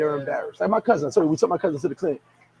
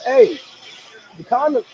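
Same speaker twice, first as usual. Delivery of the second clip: ordinary delivery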